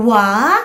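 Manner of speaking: "wa" with no final n; child's open-ended "wa"; final consonant dropped. Shown as a demonstration. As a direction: say "wa" like a toddler, ending left open. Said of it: "pag nabibilang siya ng wa".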